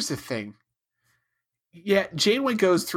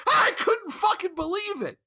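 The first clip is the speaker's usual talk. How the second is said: exasperated shout; 12 semitones higher than usual. Real, not acted. Podcaster finishing his rant about Janeway, exasperated shout. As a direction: throw it out in disbelief.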